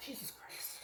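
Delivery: breathy whispered oath under the breath; whispered curse. Real, not acted. Reviewer overwhelmed by the scent, whispered curse. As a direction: let it slip out in a whisper.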